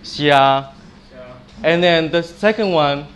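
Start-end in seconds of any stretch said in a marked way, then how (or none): 0.0-0.7 "Xia" drawn out evenly; long flat tone. Shown as a demonstration. Teacher repeating the first tone for students, "Xia" long and level. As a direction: hold "Xia" steady on one pitch. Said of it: "it goes a bit longer".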